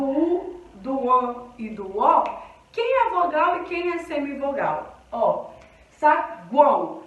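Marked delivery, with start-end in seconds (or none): 5.9-7.0 saguão slowly and louder, the u a light glide; slow clear demo. Shown as a demonstration. Teacher modelling saguão slowly and louder. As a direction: say saguão with a barely heard u. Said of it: "o U, ele quase não aparece".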